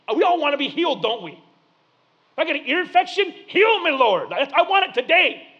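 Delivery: high, loud, fervent pulpit cry; full-force preaching. Real, not acted. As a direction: preach it out loud to the whole room.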